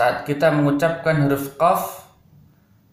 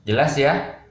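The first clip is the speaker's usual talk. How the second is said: ordinary talk, nothing marked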